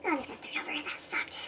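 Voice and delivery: real whisper to oneself; whispered aside. Real, not acted. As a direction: mutter it under your breath. Muttered outtake aside to herself.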